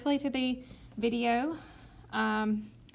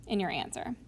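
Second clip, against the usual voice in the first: whisper; 4 semitones lower, 6 dB quieter than usual. Real, not acted. Whispered aside.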